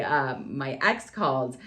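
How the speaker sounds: usual speaking voice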